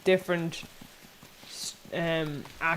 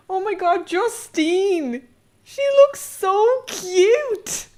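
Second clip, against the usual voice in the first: very high, loud gushing squeal; excited squeal; 14 semitones higher, 9 dB louder, much slower than usual. Real, not acted. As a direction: gush over something adorable. Squeals at cute character.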